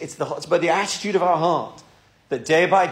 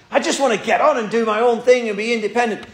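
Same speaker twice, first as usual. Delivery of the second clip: higher, complaining voice of an impatient son; frustrated son; 6 semitones higher, 3 dB louder than usual. Retold or imitated. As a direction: whine like a young man who wants to be left alone. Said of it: "the son's getting a bit frustrated and says".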